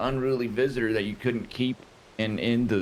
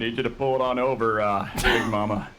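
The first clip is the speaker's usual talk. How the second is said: commanding traffic cop; pull-over order in cop voice; 3 semitones lower than usual. Pretend. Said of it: "a police officer that sounds like that".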